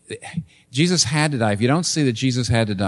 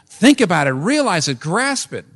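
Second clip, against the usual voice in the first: urgent preaching; 6 semitones higher, 4 dB louder than usual. Retold or imitated. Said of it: "He says".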